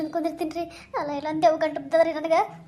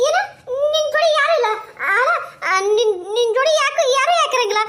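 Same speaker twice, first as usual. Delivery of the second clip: high, pleading village woman voice, close to tears; frightened woman; 4 semitones higher, 6 dB louder than usual. Acted. A scared woman begging the ghost to leave her, high tearful plea. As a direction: beg the ghost to go away, voice pitched up.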